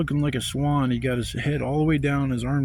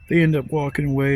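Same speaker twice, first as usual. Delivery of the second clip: usual speaking voice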